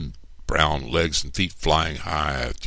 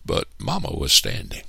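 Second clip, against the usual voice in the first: ordinary delivery, nothing marked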